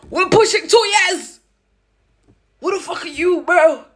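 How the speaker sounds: high, loud outraged yell; outraged shout. Retold or imitated. Confronting the boyfriend of two years, outraged shout. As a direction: blow up at him.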